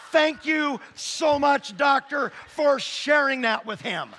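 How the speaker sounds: loud, high, slow gushing gratitude; hammed-up wife thanking the doctor loudly; mock gushing thanks. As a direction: overdo the grateful wife pumping his hand.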